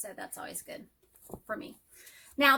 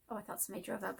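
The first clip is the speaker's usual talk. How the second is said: ordinary talk, nothing marked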